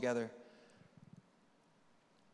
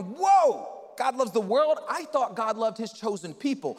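much higher and louder astonished whoa; shocked Nick; 6 semitones higher, 8 dB louder than usual. Retold or imitated. Nick hearing God loves the world, stunned. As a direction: gasp out the surprise. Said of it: "you're going".

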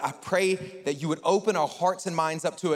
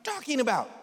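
high, incredulous acted question; mock bewilderment, higher voice; baffled disbelief; 7 semitones higher than usual. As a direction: play the utterly lost listener, pitched up. Acting Nicodemus baffled by Jesus's speech.

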